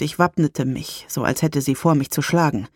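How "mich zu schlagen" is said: ordinary delivery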